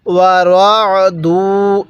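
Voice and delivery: "ওয়া রাদু" slow, with a rounded ro and long duu; measured recitation. Shown as a demonstration. Teacher showing how letters join, slow recitation. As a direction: stretch both syllables of রাদু. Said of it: "দাল লাগবে ওয়াওয়ের সাথে".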